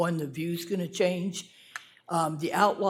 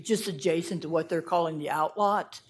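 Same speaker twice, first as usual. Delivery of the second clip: ordinary talk, nothing marked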